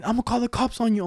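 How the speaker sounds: high-pitched mock threat; mad lady driver. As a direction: imitate an irate woman driver threatening. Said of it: "this one lady, bro, got so mad".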